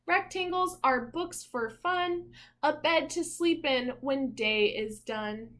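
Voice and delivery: reading aloud. Acted. Parent reading a picture book to little Zeke, in a rhythmic storybook voice. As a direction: read each rhyme out like a storybook page.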